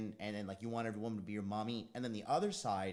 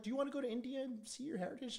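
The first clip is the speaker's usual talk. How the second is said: mock asker; 13 semitones higher than usual. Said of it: "people are like".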